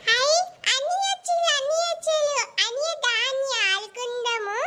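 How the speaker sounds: put-on sing-song kid sister begging to play; little sister voice. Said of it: "అన్నకు తగ్గ చెల్లులు".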